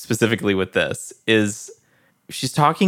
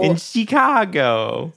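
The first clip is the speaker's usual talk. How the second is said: slow, high Southern drawl on Chicago; mock Southern drawl; 8 semitones higher, much slower than usual. Echoing the cohost's mockery, slow high Southern drawl. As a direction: stretch it out like a Louisiana belle. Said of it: "weird Southern drawl".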